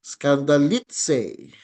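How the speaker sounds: "skandalitse" slowly, pitch peaking on "li"; drawn-out "skandalitse" with accent on "li"; stress on LI. Shown as a demonstration. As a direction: lean on the "li" in "skandalitse". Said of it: "sa li yung, yung, um, accent".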